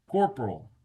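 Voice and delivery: ordinary talk, nothing marked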